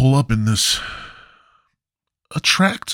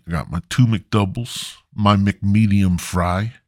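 usual speaking voice

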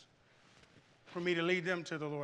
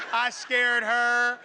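slow words through laughter; laughing; 5 semitones higher, 8 dB louder, much slower than usual. Real, not acted. Laughing about the scare.

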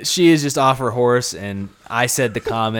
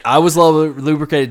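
higher, louder insistence; loud protest; 5 semitones higher, 7 dB louder than usual. Real, not acted. Defending himself to teasing friend, loud protest. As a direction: talk over them to defend yourself.

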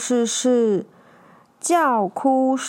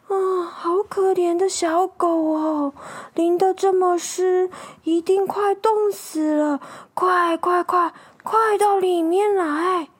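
higher, drawn-out sympathetic grandma voice; pitying old woman; 4 semitones higher, slower than usual. Acted. Old woman takes pity on freezing puppy, higher concerned voice. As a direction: fuss kindly over a poor creature. Kind old couple find soaked dog.

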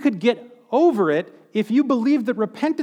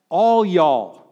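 southern drawl; 2 semitones lower than usual. Pretend. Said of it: "probably not in a southern drawl".

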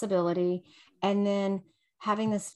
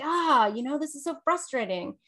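mock indignant; 6 semitones higher than usual. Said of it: "when you get indignant".

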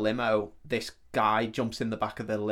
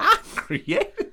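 high, laughing cartoon Southern voice; a laughing, overblown Southern twang; 4 dB louder than usual. Mocking the villain for a friend in a laughing cartoon Southern voice. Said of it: "You go full cartoon".

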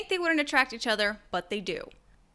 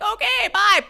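loud, high, abrupt goodbye; mock cheery farewell; 10 semitones higher, 10 dB louder than usual. Two avoidants splitting up at once, mock cheery farewell. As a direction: toss out a loud, high sign-off.

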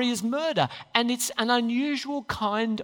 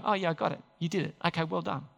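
lightly voiced king, low and soft; king's casual acknowledgement; 5 semitones lower, 7 dB quieter, faster than usual. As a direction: play down the king's reply, low and offhand. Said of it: "'Cause the king will go".

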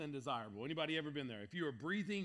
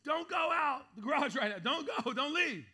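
higher, loud calls to his wife; urgent yell; 10 semitones higher, 8 dB louder than usual. Retold or imitated. Husband yells to his wife not to leave, loud urgent call. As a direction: yell a warning down the house. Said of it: "So I yelled".

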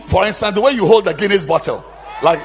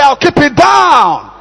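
slow, higher shouted chant; 7 semitones higher, 8 dB louder, slower than usual. Shown as a demonstration. Preacher leads congregation in slow shouted chant. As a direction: drag it out like a rallying cry.

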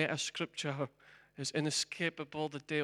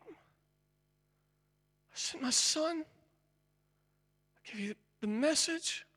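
slow God's plea; 12 semitones higher, much slower than usual. Acted. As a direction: stretch each word, longing. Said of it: "And God said".

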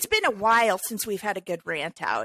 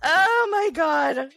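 laughing exclamation; 8 semitones higher, 5 dB louder, much slower than usual. Real, not acted. Reacting to demon joke.

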